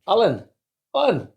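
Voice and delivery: loud, high mock call to a mate; mickey-taking. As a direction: call the name out teasingly, pitch jumping up then falling. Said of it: "waiting to take the mick".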